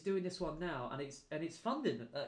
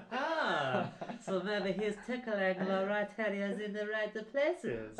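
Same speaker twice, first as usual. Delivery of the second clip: put-on Italian accent; 6 semitones higher, 5 dB louder, much slower than usual. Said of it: "I don't know why I'm gonna do an Italian accent".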